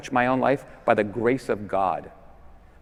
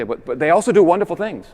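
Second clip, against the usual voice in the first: higher, louder, protesting tone; mock objector; 6 semitones higher, 7 dB louder than usual. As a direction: voice the objection with a raised, insistent pitch. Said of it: "some people say".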